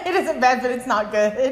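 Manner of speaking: laughing through the words; giggling while she talks. Real, not acted. Laughing at critique of her cooking.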